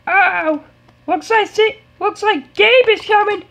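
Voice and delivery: high, hyped ring commentator; excited announcer. Pretend. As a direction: hype up the entrance.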